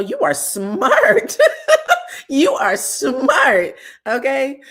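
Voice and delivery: high, loud laughing-crying; laughing through tears. Real, not acted. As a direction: laugh and sob the praise out, slowly.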